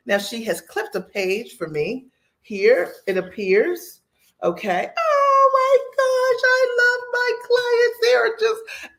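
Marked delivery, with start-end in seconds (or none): 5.0-8.9 overwhelmed with gratitude, on the edge of crying; high, tearful gush of love for her clients; near tears. Real, not acted. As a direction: voice leaping high, choked with happy tears. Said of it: "I could cry on here".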